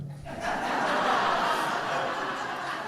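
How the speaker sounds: soft airy laugh at himself; quiet embarrassed chuckle; breathy laugh. Real, not acted. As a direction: let out a silent, helpless laugh. Laughing at own broken answer.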